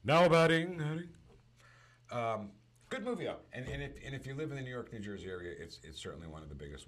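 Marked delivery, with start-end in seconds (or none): 0.0-1.1 booming announcer call, much higher and louder, echoing; stadium announcer. Pretend. Doing the Yankee Stadium announcer, booming and echoing. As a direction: belt it out over the PA.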